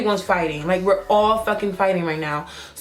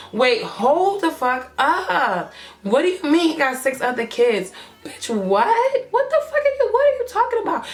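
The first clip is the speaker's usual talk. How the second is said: incredulous outburst; 8 semitones higher than usual. Retold or imitated. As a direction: replay stunned disbelief, pitch shooting up. Acted shock at six other kids.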